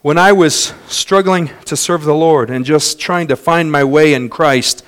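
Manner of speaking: ordinary talk, nothing marked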